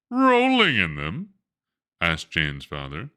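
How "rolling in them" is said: high, loud disbelieving echo; shocked question. Acted. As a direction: echo it back in disbelief, much higher. Father shocked at phone news.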